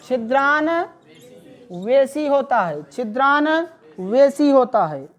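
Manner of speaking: छिद्रान्वेषी drawn out syllable by syllable; slow dictation. Shown as a demonstration. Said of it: "छिद्रान्वेषी लिखें". (धीरे-धीरे लिखवाना)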